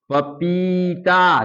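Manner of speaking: each syllable stretched, falling at the end; slow blended reading. Shown as a demonstration. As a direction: read it slowly for the children. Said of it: "अब ये तीनों अक्षर मिलाकर क्या पढ़ेंगे".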